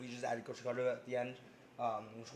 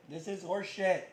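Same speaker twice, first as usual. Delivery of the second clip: higher, slower mock indignation; mock outrage; 7 semitones higher, 3 dB louder, slower than usual. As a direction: play up the outrage. Joking complaint, higher slower.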